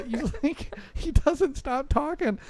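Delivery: ordinary delivery, nothing marked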